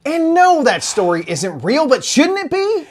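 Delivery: high, loud and eager; excited. Acted. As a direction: pitch it with big excitement. Excited pitch for a fake story.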